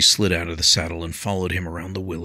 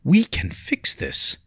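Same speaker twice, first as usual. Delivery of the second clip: pleading protest; 10 semitones higher, 6 dB louder than usual. A narrator's reading. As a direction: insist it can still be mended. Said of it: "she protested".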